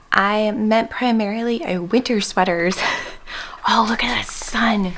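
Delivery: ordinary delivery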